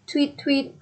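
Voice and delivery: high spoken tweets; bird chirp. A narrator's reading. Bird flies past the pullers, chirping.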